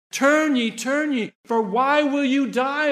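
high, urgent prophet's appeal; Ezekiel. Retold or imitated. Prophet pleading with the house of Israel, high appeal. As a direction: call out scripture as an entreaty. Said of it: "that's why Ezekiel said".

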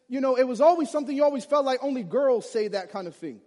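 ordinary talk, nothing marked

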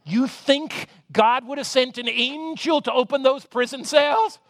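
normal talking voice

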